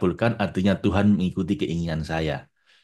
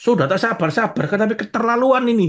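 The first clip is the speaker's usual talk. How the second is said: much higher and louder, fed up; exasperated complainer; 14 semitones higher, 4 dB louder than usual. Retold or imitated. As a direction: act the one whose patience snaps. Impatient person voiced.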